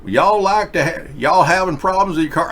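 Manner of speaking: put-on Southern helper repeats his offer, in a Southern accent. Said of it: "talking to me in a southern accent".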